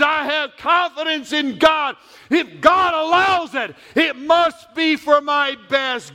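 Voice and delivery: shouted, high preaching climax; preaching at full force. Acted. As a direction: build the sermon to a soaring shout.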